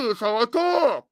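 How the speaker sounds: words slurred together, hard to understand; mumbled voice. Pretend. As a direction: mumble it like a mouthful. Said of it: "Yeah, what?".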